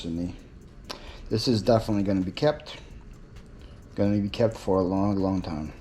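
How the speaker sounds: quiet, teary thanks; softly, fighting not to cry; holding back tears. Real, not acted. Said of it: "Try not to cry".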